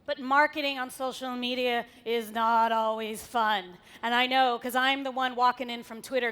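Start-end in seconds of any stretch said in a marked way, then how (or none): none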